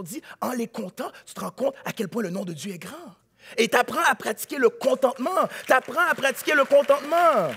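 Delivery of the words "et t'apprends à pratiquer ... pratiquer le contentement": repeated louder; building emphasis. Real, not acted. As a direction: hammer the point home. Preaching repetition, rising force. (répété avec plus de force)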